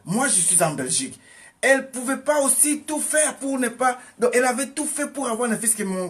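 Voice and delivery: high, loud testimony preached out; fervent preaching. Acted. Preacher telling his flock a miracle, fervent preaching. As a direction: drive the story home to the church.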